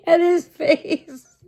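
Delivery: high, loud words through real laughter; can barely get it out for laughing; laughing hard. Real, not acted. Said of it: "I laughed so hard at that".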